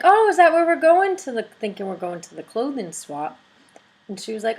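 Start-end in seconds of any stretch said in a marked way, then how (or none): 0.0-1.4 excited guess. Retold or imitated. Excited guess spotting balloons.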